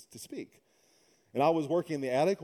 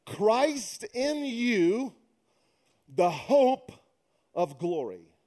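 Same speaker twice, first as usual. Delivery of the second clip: preached quote; 4 semitones higher, much slower than usual. Acted. Quoting Paul with emphasis.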